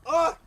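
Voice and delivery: loud, high burst of laughter; real uncontrollable laughter; loud laughing shriek. Real, not acted. Cracking up mid-story.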